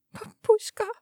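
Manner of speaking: high, soft name as the voice breaks; voice breaking. A narrator's reading. Daughter calls her injured father, voice breaking high. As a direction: let the voice crack on the name. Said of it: "Meine Stimme bricht".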